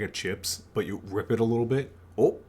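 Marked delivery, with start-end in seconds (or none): 2.2-2.4 a quick startled Oh said as ope; Midwest ope. Shown as a demonstration. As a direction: blurt Oh like a Midwesterner bumping someone. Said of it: "the ope".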